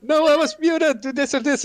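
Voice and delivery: high, hurried mock outcry; imitated screaming. Retelling the muted shot caller, mock screaming. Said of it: "He was just screaming like".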